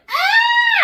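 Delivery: high, loud mock scream; shrieking. Middle schoolers seeing the French teacher's armpit, shrieking. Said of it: "we'd be like".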